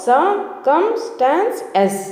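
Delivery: slow model word; silent r. Shown as a demonstration. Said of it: "మింగేసేయాలి ఆర్ అనేది".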